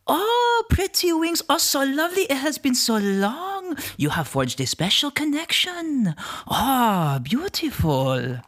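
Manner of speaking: awestruck high-pitched forest spirit; enchanted tree creature. Acted.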